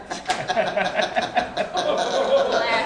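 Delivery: big burst of real laughter; loud laugh. Real, not acted. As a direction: crack up loudly. Cast cracks up.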